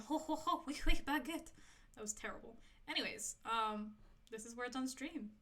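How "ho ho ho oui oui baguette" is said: hammed-up French accent, slightly higher; a cheesy cartoon French accent; mock French accent. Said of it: "here's French accent".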